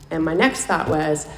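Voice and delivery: ordinary talk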